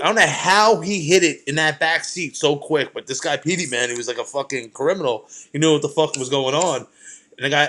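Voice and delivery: ordinary delivery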